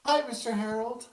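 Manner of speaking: put-on high childish voice, playful; a little girl popping out to startle; eager ghost child.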